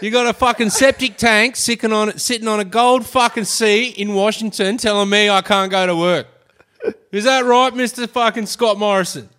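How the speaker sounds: loud, high comic rant at the PM; angry tradie mocking the prime minister. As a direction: tear into the government, hammed up.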